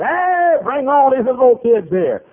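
high, loud mimicked complaint; mock indignant critic. Pharisees criticizing bus workers over the kids, mock indignant shout. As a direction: call out a loud objection.

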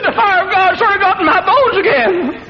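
put-on high old man voice, laughing; frail old man, joyfully laughing; squeaky old man voice. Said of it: "in a squeaky voice".

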